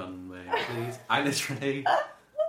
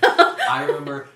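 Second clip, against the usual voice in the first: high, loud, laughing through the words; 13 semitones higher, 15 dB louder, slower than usual. Real, not acted. Co-host cracking up mid-story, laughing through the words. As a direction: laugh it out, stretched.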